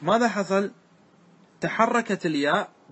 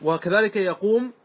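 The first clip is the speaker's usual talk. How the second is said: normal talking voice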